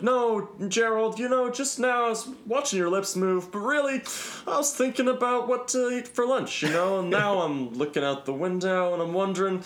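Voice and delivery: high, spacey droning executive who isn't listening; self-important executive. Retold or imitated. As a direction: play a pompous boss lost in his own thoughts. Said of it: "in this, like, "I'm an executive and my thoughts are important".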